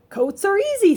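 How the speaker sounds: high and loud, sure of herself; confident cheer. A narrator's reading. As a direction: brightly dismiss the problem. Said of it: "said Amelia Bedelia".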